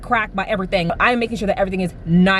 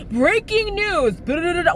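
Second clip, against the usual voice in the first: mock news anchor; 7 semitones higher than usual. Mocking constant news alerts.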